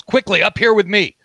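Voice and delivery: high, urgent call to an ally; acted yell. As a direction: call someone up to you in a hurry. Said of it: "looks at Rekestric and yells".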